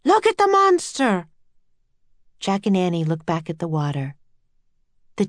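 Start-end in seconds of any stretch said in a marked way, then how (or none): 0.0-1.3 high, alarmed shriek; sailor's shriek. A narrator's reading. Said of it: "a sailor shrieked".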